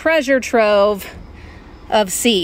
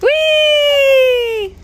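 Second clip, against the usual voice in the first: high loud joyful squeal; excited whee; 6 dB louder than usual. Real, not acted. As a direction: squeal with delight. Ride excitement cheer.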